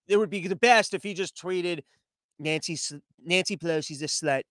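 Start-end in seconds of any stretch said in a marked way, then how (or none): 2.4-4.4 lightly voiced, low and soft mock politician; a hint of a presidential impression, dropped low; low, quiet mock tweet in his voice; Donald Trump. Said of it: "Trump's first tweet back".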